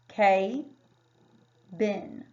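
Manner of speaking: "K, bin" said as two chunks with a long A, like kay-bin; wrong split K bin. Shown as a demonstration. Teacher demonstrating the wrong long-A split of cabin. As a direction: sound it out the wrong way as "K" then "bin". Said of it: "this word would be pronounced".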